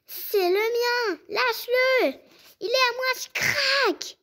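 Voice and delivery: high, loud, frantic yelling; furious cry. Acted. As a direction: lose it and scream. Fighting over an object.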